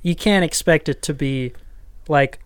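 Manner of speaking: ordinary talk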